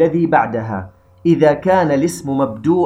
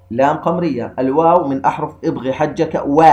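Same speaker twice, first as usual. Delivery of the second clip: ordinary talk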